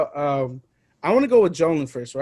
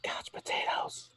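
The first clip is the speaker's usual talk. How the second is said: slow, hushed whisper of the title; slow whispered title drop; whispered show name; 15 dB quieter, slower than usual. Acted. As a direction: breathe the show's name like a secret. Show name after interruption.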